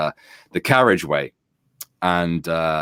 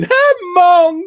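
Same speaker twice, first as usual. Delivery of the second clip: put-on high, loud kid's voice; child's voice; 8 dB louder than usual. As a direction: shout it like a gleeful kid. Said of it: "He was going".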